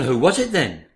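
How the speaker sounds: ordinary talk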